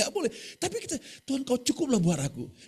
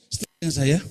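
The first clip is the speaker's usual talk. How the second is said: louder, pushing for a response; 6 semitones lower, 9 dB louder, faster than usual. Shown as a demonstration. Preacher to congregation, asking again louder. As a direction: raise the voice to get an answer.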